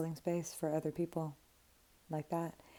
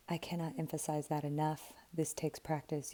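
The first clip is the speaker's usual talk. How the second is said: normal talking voice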